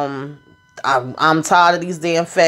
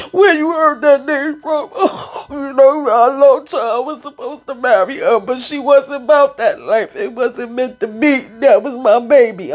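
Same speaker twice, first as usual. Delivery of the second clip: higher, choked-up retelling of a lost love; emotional Tommy; 6 semitones higher than usual. Retold or imitated. Tommy telling Maria about Lakeisha, emotional and pitched up. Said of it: "Tommy starts getting emotional".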